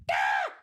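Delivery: mock straining cry of frustration; acted exasperated "ah"; frustrated yell. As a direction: cry out as if wrestling a stuck lid. Struggling with the pickle jar.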